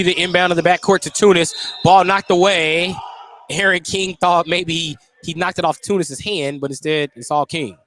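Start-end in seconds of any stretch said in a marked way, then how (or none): none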